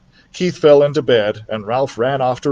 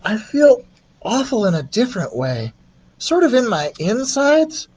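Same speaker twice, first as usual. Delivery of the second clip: put-on a boy's voice, higher, uneasy; sick boy voice, higher; 11 semitones higher than usual. Keith tells Ralph he feels sick, higher and uneasy. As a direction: sound like a queasy little boy.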